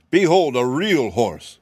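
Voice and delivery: grand proclamation. Acted. Cab horse presenting himself to the sawhorse, slow and grand. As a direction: announce it grandly. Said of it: "he cried".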